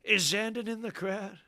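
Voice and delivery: lightly voiced announcer working the crowd; mock rally hype; stadium hype call. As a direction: call it out like a concert host to the arena. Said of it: "I'd be like".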